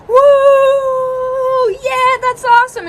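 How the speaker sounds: long high whoop of celebration; excited cheer. Retold or imitated. Mom and daughter celebrating a passed class, excited cheer. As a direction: cheer it high, holding the woo.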